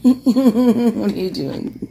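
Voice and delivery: slow, laughing question. Real, not acted. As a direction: laugh through the words. Laughing at baby.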